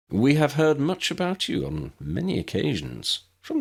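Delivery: lifted polite visitor voice; courteous, formal guest. A narrator's reading. Ambrose voiced high.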